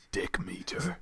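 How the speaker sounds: slow amused whisper; slow whispered dick meter; whispered joke. Real, not acted. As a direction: breathe it out with a laugh.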